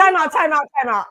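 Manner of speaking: calling time out. Real, not acted. Calling a halt on the show.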